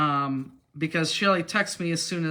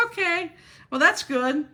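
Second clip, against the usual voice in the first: high, doubtful okay that trails into a louder that's good; unconvinced trainer; 11 semitones higher, 5 dB louder than usual. Retold or imitated. Trainer answering the nephew story, high and skeptical. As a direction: sound like she doesn't believe it one bit.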